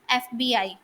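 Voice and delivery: FBI read as separate letters, "एफ़-बी-आई"; spelled-out letters. Shown as a demonstration. Teacher giving students an example of an initialism, spelled letter by letter. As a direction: say each letter name of "एफ़बीआई" separately. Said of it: "letters को अलग-अलग pronounce करेंगे".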